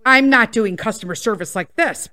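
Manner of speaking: normal talking voice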